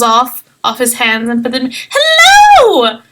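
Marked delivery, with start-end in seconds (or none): 1.9-3.0 very high, loud cheery greeting; a tiny ancient halfling delighted by visitors; old halfling shopkeeper calls out hello; little old halfling. Acted.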